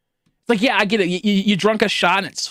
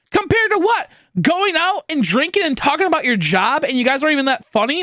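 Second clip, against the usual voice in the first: high, incredulous pushback; exasperated friend arguing for movie night; indignant retort; 10 semitones higher than usual. Retold or imitated. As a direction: fire back at friends in disbelief. Retold argument with friends.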